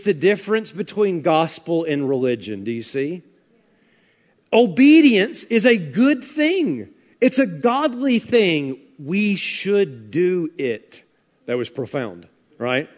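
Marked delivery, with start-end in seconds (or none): none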